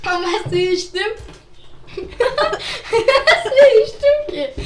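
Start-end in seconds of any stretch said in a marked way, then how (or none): 2.0-4.5 laughing through the words; can't stop laughing, slow. Real, not acted. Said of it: "sen niye güldün".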